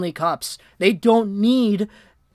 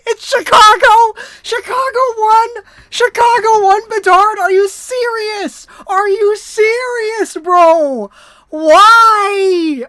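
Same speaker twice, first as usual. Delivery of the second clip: much higher and louder, crying out in disbelief; real anguished outburst; 11 semitones higher, 9 dB louder, much slower than usual. Real, not acted. Streamer screaming at the stream as Chicago wins the draft lottery, real anguished shout. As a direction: yell it like your worst nightmare just came true.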